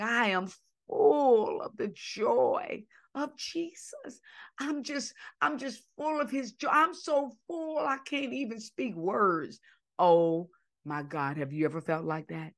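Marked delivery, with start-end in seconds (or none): 0.0-9.6 a touch of crying, voice raised higher; choked with joyful tears, pitched up; happy tears. Retold or imitated. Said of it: "she was really saying".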